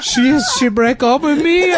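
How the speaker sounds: put-on very high, loud Mexican-accented wail; hammed-up sobbing heartbreak, stammering the start; mock crying. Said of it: "then he starts crying on my shoulder".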